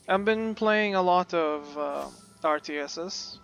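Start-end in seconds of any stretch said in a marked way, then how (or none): none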